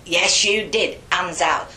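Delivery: loud, cross nun's order; insist, then order the punishment; stern insistence. Retold or imitated. Acts nun ordering caning.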